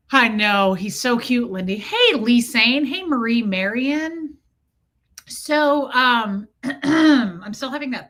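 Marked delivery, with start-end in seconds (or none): none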